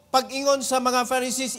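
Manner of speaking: ordinary delivery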